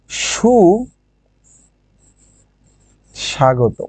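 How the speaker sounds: সুস্বাগত drawn out very slowly; wrong form. Shown as a demonstration. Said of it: "এটি ভুল". (ভুল উদাহরণ হিসেবে টেনে বলা সুস্বাগত)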